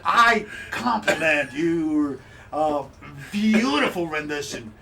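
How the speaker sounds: lavish the praise, hammed up; gushing praise. Tavern keeper gushing over goat impression.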